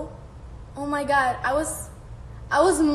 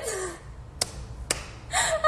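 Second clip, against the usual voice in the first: breathless laugh; 7 semitones higher, 9 dB quieter than usual. Real, not acted. Cracking up at a comment.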